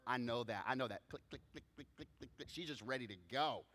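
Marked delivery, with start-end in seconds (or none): 1.1-2.5 soft, toneless clicking; hushed percussive heel-click sounds; imitated heel clicks. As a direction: mimic heels on a hard floor. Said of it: "wife is wearing high heels".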